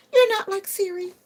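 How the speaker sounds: high, loud put-on voice on "You're not like Siri"; mock scolding. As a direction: tease the phone in a squeaky voice.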